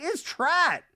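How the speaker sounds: high, slow hammed-up Reba; lofty slow mock-mom rant. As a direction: drone it like a grand old dame. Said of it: "and you're like".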